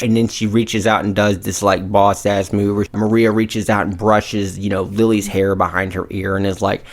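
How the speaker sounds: usual speaking voice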